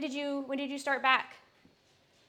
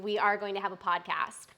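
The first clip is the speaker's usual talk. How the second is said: ordinary delivery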